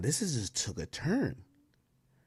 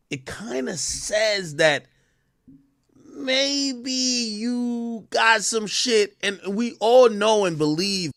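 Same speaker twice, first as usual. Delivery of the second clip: emphatic build; 9 semitones higher, 6 dB louder, slower than usual. Real, not acted.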